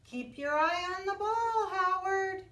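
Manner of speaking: put-on a teammate shouts to the boy during a ball game. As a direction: yell encouragement across the field.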